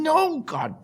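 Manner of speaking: high, exasperated mock outburst; angry customer. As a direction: imitate an annoyed customer snapping. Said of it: "he starts cussing".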